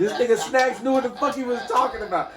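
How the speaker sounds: loud, high, laughing hype; laughing hyped-up shout; excited mock praise. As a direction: shout it like the guess just came true. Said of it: "Be like".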